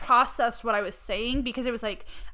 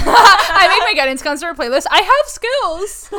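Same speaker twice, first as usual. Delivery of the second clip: laughing; 8 semitones higher, 12 dB louder than usual. Real, not acted. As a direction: laugh out the boast. Laughing, mock proud.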